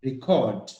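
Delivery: "Record" as a verb, stressed on the second syllable CORD. Shown as a demonstration. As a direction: say "Record" as re-CORD, falling at the end. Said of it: "C O R".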